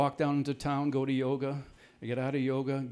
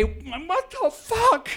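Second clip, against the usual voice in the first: high, loud, slow stretched outcry; screaming with a laugh in it; shocked shout; 14 semitones higher, 6 dB louder, much slower than usual. Retold or imitated. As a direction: yell it out in disbelief. Retold outburst at the sting.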